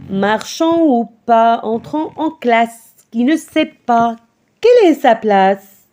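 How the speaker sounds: measured, rhythmic poem reading, phrase by phrase; poem recitation. Acted. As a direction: recite it to a class with clear, even beats.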